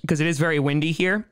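ordinary talk

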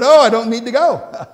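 loud, laughing and joyful; laughing reply. Retold or imitated. Husband telling his wife he's been met, laughing. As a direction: laugh it out with joy. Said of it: "I said".

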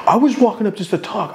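high-pitched retold protest. Man protesting to his rival that he only walked up to talk, high protest.

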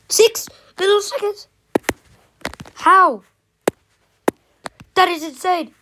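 high, loud astonished cries; excited. Real, not acted. Reacting to an impossible time, excited high shouts. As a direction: yelp it out in amazed disbelief. Beating the record, thrilled.

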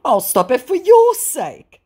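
high and loud promise; hammed-up pledge; mock scammer voice. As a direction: scammer promising to stop, mocked. Voicing scammer.

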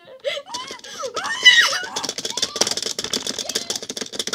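loud high shrieking laugh. Real, not acted. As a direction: scream with laughter. Laughs after being killed.

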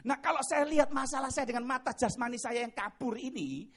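normal talking voice

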